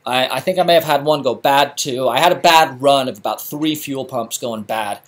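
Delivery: ordinary talk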